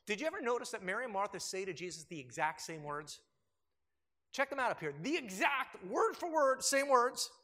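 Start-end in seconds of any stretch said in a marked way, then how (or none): none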